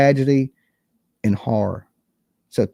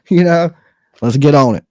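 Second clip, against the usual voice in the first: a sudden loud burst of enthusiasm; rally the listeners, louder and keen; excited push; 2 semitones higher, 8 dB louder than usual. Real, not acted. Pushing his million dollar idea, loud and excited.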